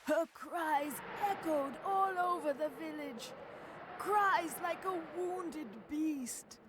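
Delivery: storyteller swept up in grief; anguished lament. Acted. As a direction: cry the lines out in despair. Narrating Lily's despairing cries.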